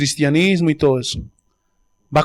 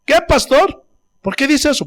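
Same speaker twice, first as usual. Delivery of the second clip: mock offended churchgoer; 7 semitones higher than usual. Feligrés ofendido, voz imitada.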